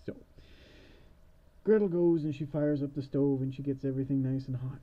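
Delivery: normal talking voice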